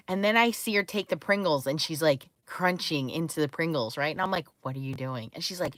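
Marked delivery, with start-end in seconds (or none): 4.6-5.3 much lower and softer, almost whispered; hushed retold question. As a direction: drop to a hush so you don't spoil the recording. Daughter recording ASMR, mom hushed.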